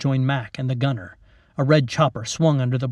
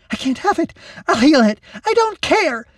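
high, loud frantic screaming; girl screaming wildly at the soldiers; 6 dB louder than usual. Acted. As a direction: scream it out of control. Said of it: "Lacey screamed, wild".

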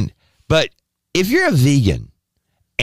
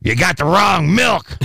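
slow, mock-indignant complaint; the cat scolds its owner, slow and huffy; grumpy cat; 6 semitones higher, slower than usual. The cat complaining to its owner about the milk, slow huffy. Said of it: "the cat comes in and says".

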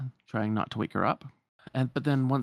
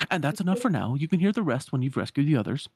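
raised, louder wardrobe voice; brisk wardrobe; 7 semitones higher, 5 dB louder, faster than usual. Acted. Wardrobe telling rescuers the rest must wait, brisk and teasing. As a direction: break off the storytelling playfully. Wardrobe cuts her story short.

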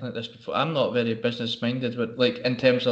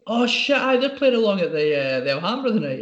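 higher-pitched retold "oh no" moment; dawning realisation; 7 semitones higher than usual. Barber realising who he turned away, dawning realisation. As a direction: act out a sudden penny-drop, pitched up.

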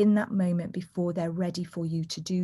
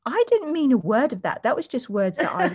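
laughing dismissal; 5 semitones higher, 4 dB louder than usual. Retold or imitated. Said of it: "she went".

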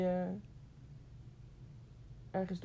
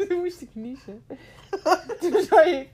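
laughing; 8 semitones higher, 13 dB louder than usual. Real, not acted. Retelling through laughter.

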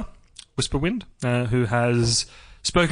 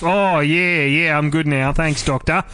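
ocker Australian. Pretend. Australian companion calling the Doctor for help, broad ocker accent. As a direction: hail the Doctor like a laid-back Aussie bloke. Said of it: "Did they talk like that?".

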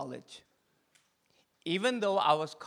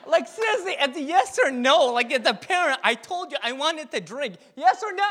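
much higher and louder, demanding an answer; calling out; 9 semitones higher, 9 dB louder than usual. Real, not acted. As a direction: shout to the crowd for a yes or no.

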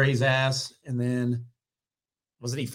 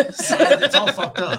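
high, slow laughing groan; 11 semitones higher, 5 dB louder, much slower than usual. Real, not acted. Laughing with friends at the scissoring craze. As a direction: laugh it out in disbelief.

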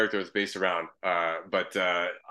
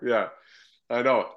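normal talking voice

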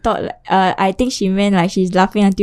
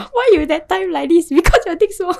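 mock nagging girlfriend; 10 semitones higher than usual. Mocking an imagined argument.

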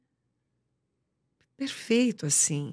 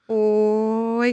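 louder catchphrase; 5 dB louder than usual. Shown as a demonstration. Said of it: "porque era "Oi", né?".

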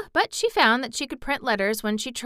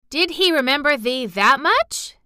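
louder, higher amazed question; exclaiming; 6 semitones higher, 6 dB louder than usual. A narrator's reading. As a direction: gasp it out in amazement. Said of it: "exclaimed Martha".

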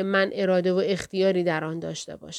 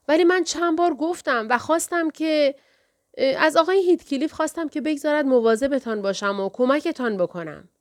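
flustered anger; 6 semitones higher than usual. A narrator's reading. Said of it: "از کوره در رفته بود".

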